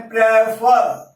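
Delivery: Prefer slowly, in two separate pieces; split into syllables. Shown as a demonstration. Teacher splitting a word for students, slow two-syllable demo. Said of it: "दो हिस्सों में तोड़ दिया है".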